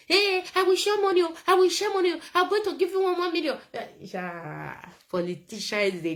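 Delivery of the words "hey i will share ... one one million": high, loud, fast mock promise; mocking Sowore shouting. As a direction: ham up the politician's cash promise. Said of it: "Sowore is now shouting that".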